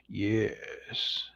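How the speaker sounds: hushed, whispery low yes; low, drawn-out villain hiss; sinister near-whisper; deep villain voice. Shown as a demonstration. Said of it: "It has to be a deep voice".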